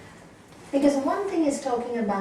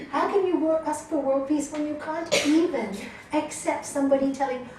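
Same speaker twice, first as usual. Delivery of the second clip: usual speaking voice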